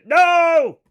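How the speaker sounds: real outburst, high and very loud; abrupt high-pitched shout of protest; sudden shouted no. Real, not acted. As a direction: cut yourself off with a yelled no. Cuts himself off with sudden outcry.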